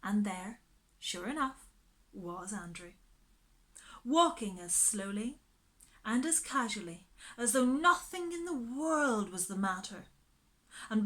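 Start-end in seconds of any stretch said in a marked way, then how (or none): none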